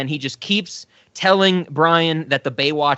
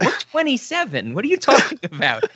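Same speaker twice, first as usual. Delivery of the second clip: higher-pitched, incredulous protest with a laugh in it; mock indignation; 7 semitones higher than usual. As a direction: play the baffled grown-up mistaken for a child. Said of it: "And be like".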